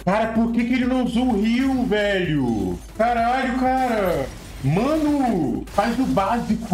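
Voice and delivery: exasperated rant. Real, not acted. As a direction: rage at a teammate's blunder. Raging at a teammate's play.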